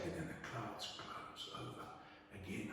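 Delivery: hushed storyteller voice. Retold or imitated. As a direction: drop to a near whisper as the clouds close.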